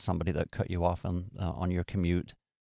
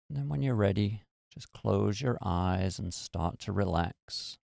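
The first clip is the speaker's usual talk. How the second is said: guided meditation. Acted. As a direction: ease them into stillness. Leads a meditation.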